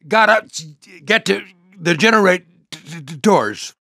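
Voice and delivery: high, slow, juddering speech; electrified stutter. Acted. Charged-up pilot struggling toward the generators, electrified stutter. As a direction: stutter through an electric jolt.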